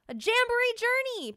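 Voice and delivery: high, loud delighted shout. Acted. Pickles celebrating the journey, high loud delighted shout. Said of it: "Pickles shouted with delight".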